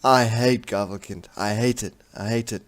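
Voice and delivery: loud, deliberate frustration easing off on each repeat; frustrated venting. Real, not acted. Player venting about Gavelkind inheritance, loud frustration. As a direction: start loud and heavy, then ease down.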